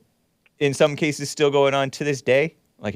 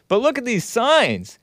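pitched way up, exasperated; mock outrage; 10 semitones higher than usual. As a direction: point at the signs in disbelief. Mocking exclaim at protest signs.

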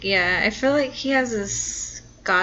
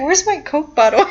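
mock whiny voice; 7 semitones higher, 8 dB louder than usual. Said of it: "He's just like".

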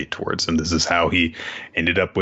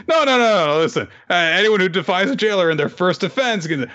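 mock pleading; 9 semitones higher than usual. Scorpion jailer begging the prisoners, high mock panic. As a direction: beg them to stop, voice jumping high.

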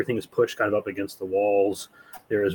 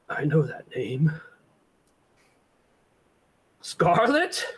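higher, stunned recognition; startled old man; 7 semitones higher than usual. Acted. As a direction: gasp out the name in shock.

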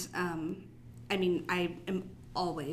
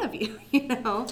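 laughing it off; 5 semitones higher, 5 dB louder than usual. Real, not acted. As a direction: say it through a light laugh. Laughs at own remark.

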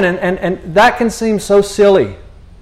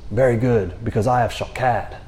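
calm reassurance; 7 semitones lower, 8 dB quieter than usual. Retold or imitated. God answering Jeremiah, calm.

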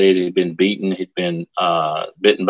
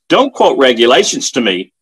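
higher, louder angry retort; storyteller's raised-voice major; retold scream; 5 semitones higher, 6 dB louder than usual. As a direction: cut in loudly as the screaming major. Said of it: "screamed the major".

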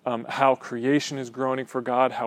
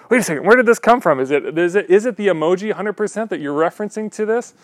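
fast, higher and louder, acting baffled; mock bewilderment; 6 semitones higher, 7 dB louder, faster than usual. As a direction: play up confused disbelief at the new slang. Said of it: "I'm like".

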